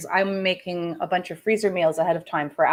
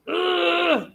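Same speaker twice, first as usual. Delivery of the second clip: exasperated ugh; 10 semitones higher than usual. Real, not acted. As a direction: let out a fed-up shout. Ranting about government, exasperated.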